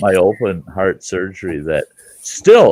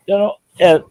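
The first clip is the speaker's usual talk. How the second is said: ordinary talk, nothing marked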